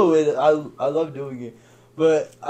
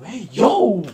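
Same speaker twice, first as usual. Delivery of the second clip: loud, high "hey, yo"; mock startled objection; 8 semitones higher, 7 dB louder than usual. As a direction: call out as if fending a friend off. Retold objection to being touched.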